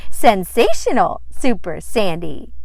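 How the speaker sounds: loud triumphant cry; a girl shouting her superhero name. Acted. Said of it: "Sandy shouted".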